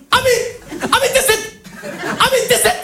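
enraged dad swallowing his words; contracted angry dad. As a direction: spit out the clipped name angrily. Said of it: "ça donne".

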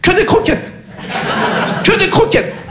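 high mock-stern vet, laughing through it. Vet firmly ordering the grandma, high mock-stern voice. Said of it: "Il lui a dit, yeux dans les yeux".

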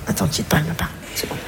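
whispered aside to the caller; hushed aside. Real, not acted. Caller on the phone, told in a hushed aside. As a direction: drop the voice so mum won't hear.

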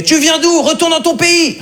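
cri xénophobe rejoué, haut perché; commentaire hostile imité. Retold or imitated. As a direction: gueuler comme un commentateur hargneux. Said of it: "un mec qui a commenté". (hargne imitée, aiguë)

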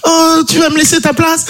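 high falsetto, sweetly hopeful; caricatured wife voice. Acted. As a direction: coo it up high as the delighted wife. Wife hopes for the seat.

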